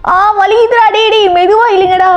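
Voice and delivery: high, pained protest; acted pain. Friend being tugged by his pals, mock pained.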